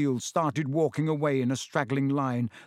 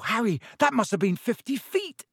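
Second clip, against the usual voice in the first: thrilled, higher and brighter; excited; 9 semitones higher than usual. Acted. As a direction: cheer him on, impressed. Impressed shout at throw.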